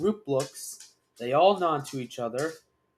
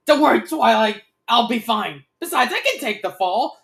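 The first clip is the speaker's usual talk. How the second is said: high, raised dragon voice; shout; 7 semitones higher, 9 dB louder than usual. A narrator's reading. Dragon held hostage tells Twilight not to worry, shouting. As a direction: call out reassurance at high pitch. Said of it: "the dragon shouts".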